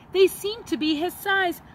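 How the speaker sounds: ordinary delivery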